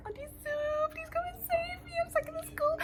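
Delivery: very high, squeaky pleading; pleading whine. Retold or imitated. Calling her aunt for rescue: squeaky high pleading. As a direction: beg like a helpless little kid. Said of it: "I'm like".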